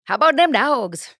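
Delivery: loud, high-pitched rallying cry; football fan cheer. Acted. Host throws out a college football catchphrase, cheering loudly. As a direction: call it out like a fan in the stands.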